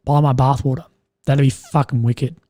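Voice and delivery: usual speaking voice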